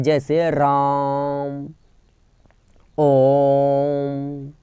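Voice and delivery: राम और ओम के स्वर को बहुत लंबा खींचकर बोलें; drawn-out vowels. Shown as a demonstration. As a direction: stretch the vowel in राम and ओम far past normal, very slow. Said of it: "प्लुत स्वर में बहुत ही ज़्यादा समय लगता है". (प्लुत स्वर की तरह राम और ओम को बहुत देर तक खींचें)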